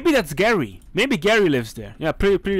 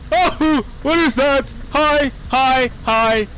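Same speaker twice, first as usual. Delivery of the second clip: mock naive greeting, higher and louder; lightly voiced dopey man cooing at a monster; clueless guy; 9 semitones higher, 6 dB louder, much slower than usual. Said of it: "this man is just".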